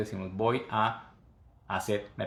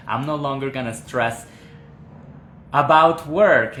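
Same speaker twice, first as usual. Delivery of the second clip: excited read; 3 semitones higher, 8 dB louder than usual. Real, not acted. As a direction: cheer the student's answer. Reading student answer.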